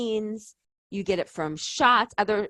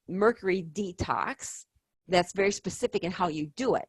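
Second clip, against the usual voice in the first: ordinary talk, nothing marked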